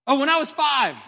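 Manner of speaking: loud, high improvised fib; blurted made-up answer. Retold or imitated. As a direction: blurt out a hasty invented excuse. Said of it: "I made something up".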